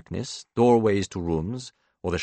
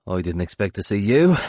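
strained jollity; 5 dB louder than usual. A narrator's reading. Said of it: "tone of strained jollity".

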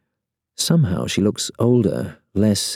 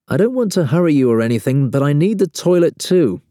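quick, high, raised-voice plea; calling through door; 10 semitones higher, 4 dB louder than usual. A narrator's reading. Man to woman in the bathroom, calling through door. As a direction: call out urgently but politely. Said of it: "I call through the door".

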